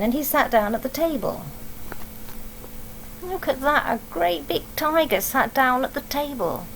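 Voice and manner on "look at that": usual speaking voice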